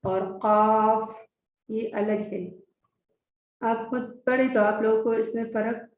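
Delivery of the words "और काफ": काफ drawn out slowly from the throat; Arabic qaf. Shown as a demonstration. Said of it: "काफ अलग एक है". (काफ को हलक़ से धीरे अदा करें)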